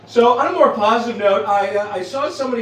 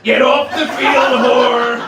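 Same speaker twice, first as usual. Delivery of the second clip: loud, higher slow yell; 5 semitones higher, 6 dB louder, much slower than usual. Retold or imitated. Grandma yelling at cart girls, loud slow yell. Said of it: "she's like".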